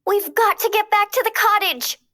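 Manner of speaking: high, urgent call to the others; fairy calling her sisters to hurry back. A narrator's reading. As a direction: call across to the fairies. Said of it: "Flora called out".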